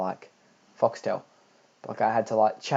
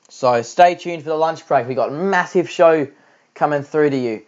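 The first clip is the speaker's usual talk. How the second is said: higher, louder announcer hype, slowing on tour matches; hyped show promo; 4 semitones higher, 4 dB louder, slower than usual. Acted. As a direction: sell the next show like a TV host. Hypes upcoming lunch break show.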